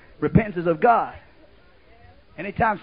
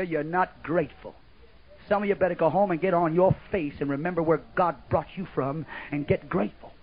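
ordinary talk

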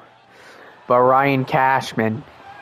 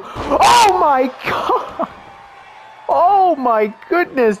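excited scream; 12 semitones higher, much slower than usual. Real, not acted. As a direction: scream in disbelief. Insane moonsault reaction.

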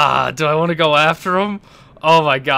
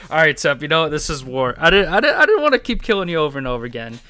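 ordinary talk

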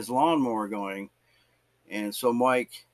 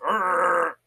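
wordless growling grunt; imitated grunt; 4 semitones higher, 4 dB louder than usual. Recluse once his lawnmower is fixed, imitated grunt. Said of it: "the guy just grunted at me".

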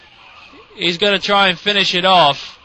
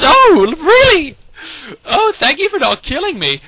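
high-pitched mock-grateful player; mock player's reaction; 6 semitones higher than usual. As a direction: act out an exaggerated, high-pitched thank-you.

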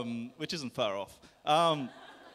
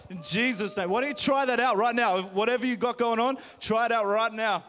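fervent, commanding prayer over the room; healing prayer; 5 semitones higher, 4 dB louder than usual. Acted. Pastor praying over the congregation, a firmly commanding healing prayer. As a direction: declare the healing with steady conviction.

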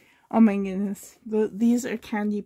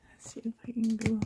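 whisper; 5 dB quieter, much faster than usual. Real, not acted. Quietly digging into the box.

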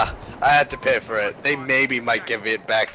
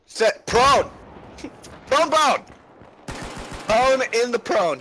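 raging gamer; 10 semitones higher than usual. Real, not acted. Frantic callouts while laughing.

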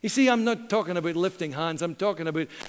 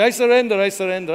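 mock surrender; 7 dB louder than usual. Childhood cowboy game surrender.

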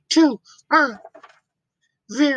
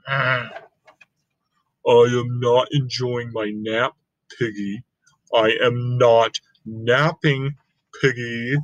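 much lower, dull Gerald voice; deep elephant voice; 12 semitones lower than usual. Acted. Gerald telling Piggy he is not napping, deep.

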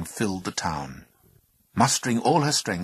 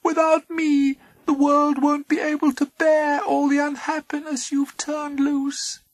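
small high pleading voice; 6 dB louder than usual. Acted. Hope pleading.

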